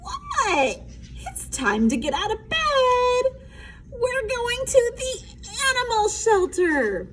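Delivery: voiced character. A narrator's reading. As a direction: play it bright and high.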